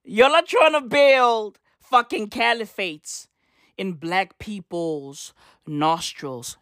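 ordinary delivery

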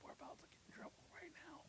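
whispered aside; hushed joking whisper. Real, not acted. Aside about getting in trouble.

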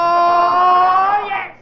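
villain's shout. Acted. Baron's grand entrance.

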